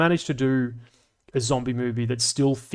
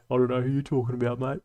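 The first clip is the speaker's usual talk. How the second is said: reading a chat line; faster than usual. Shown as a demonstration. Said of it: "That was said by Killzone".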